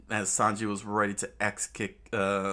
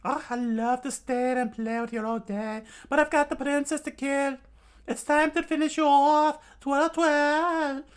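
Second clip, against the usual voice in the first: high falsetto, building louder; villain in girlish disguise; 5 dB louder than usual. Acted. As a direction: coo it, then crow the threat. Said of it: "reshaped her face again".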